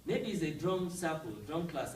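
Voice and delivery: normal talking voice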